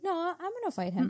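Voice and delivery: high-pitched bravado; mock-defiant and high; defiant retort. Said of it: "'cause he's like".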